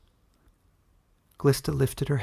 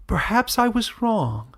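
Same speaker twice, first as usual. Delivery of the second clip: mock sweetness; 11 semitones higher, slower than usual. Villain feigns sudden recollection.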